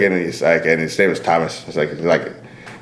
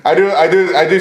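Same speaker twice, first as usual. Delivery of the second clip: laughing; 8 semitones higher, much slower than usual. Real, not acted. Laughing repeat.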